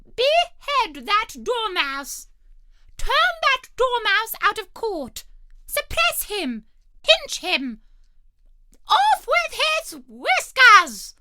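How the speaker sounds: a queen losing her temper completely; shrieking. A narrator's reading. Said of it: "the Queen shrieked out".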